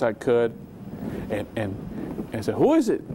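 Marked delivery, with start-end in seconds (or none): 2.5-3.0 sudden high startled call of who is it; startled question. Retold or imitated. Man hearing a strange voice calls out, startled. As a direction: bolt up and call out to the empty room, high.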